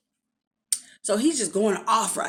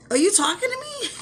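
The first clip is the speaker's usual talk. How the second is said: high-pitched incredulous question, acted out; mock-baffled, rising high; confused disbelief; 12 semitones higher than usual. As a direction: voice shoots way up, as if baffled. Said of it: "the confusion on my face".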